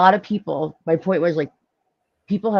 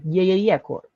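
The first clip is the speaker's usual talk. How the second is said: imitating a brother's South Philly Italian accent; light South Philly Italian-American impression. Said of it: "South Philly Italian accent".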